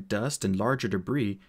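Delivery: ordinary delivery, nothing marked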